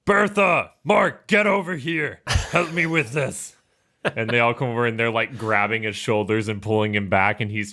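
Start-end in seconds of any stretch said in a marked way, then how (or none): 0.1-3.6 calling for help. Acted. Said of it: "He's like".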